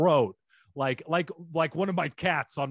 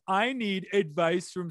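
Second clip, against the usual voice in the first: sarcastic; 6 semitones higher than usual. Shown as a demonstration. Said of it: "they made it all sarcastic".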